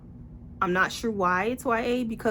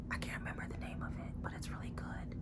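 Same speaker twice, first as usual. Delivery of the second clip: much softer, quick secret whisper; whispered aside; 18 dB quieter, faster than usual. Real, not acted. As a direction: whisper it fast like a side note. Aside while recommending books.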